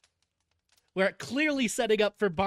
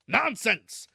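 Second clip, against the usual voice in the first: louder, higher exclamation; impatient dismissal; 6 semitones higher, 5 dB louder than usual. A narrator's reading. Uncle dismissing cousin's reluctance, impatient. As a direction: cut her off crossly.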